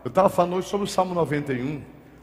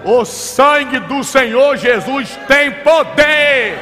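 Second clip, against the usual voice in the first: slow, much higher, loud shouted declaration; shouting prayer; 8 semitones higher, 10 dB louder, much slower than usual. Retold or imitated. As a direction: cry out the power of the blood. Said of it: "Aí eu comecei a gritar". (grito lento e forte de oração)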